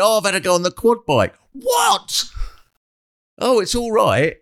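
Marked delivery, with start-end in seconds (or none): 0.0-1.3 put-on high, quick cheery kid voice; lightly imitating a child's breezy report; quoting the kid. Mother quoting her child coming in from the quad bike, breezy. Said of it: "You just come in and went". 1.5-2.2 high, shrieked What?; shocked shout. Retold or imitated. Mother recalling her reaction to the quad bike news, shrieked disbelief.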